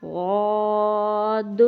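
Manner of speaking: drawn-out Arabic غاد with heavy full mouth; demo letter sound. Shown as a demonstration. Teacher models غاد slowly for students. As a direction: stretch غاد long from the throat. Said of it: "اگلا لفظ ہے غاد".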